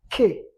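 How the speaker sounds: K as an abrupt burst, chopped off; cut-off K sound. Shown as a demonstration. Teacher demonstrates the sound to pupils, K cut short. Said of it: "ukasa nk'uciye mu kintu gutyo ukakatamo kabiri".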